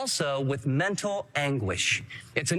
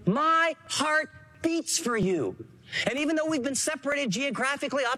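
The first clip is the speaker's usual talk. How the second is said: tender heartfelt plea; 8 semitones higher than usual. Retold or imitated. Voicing Paul's heartfelt words.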